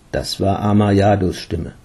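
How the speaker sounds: plain reading voice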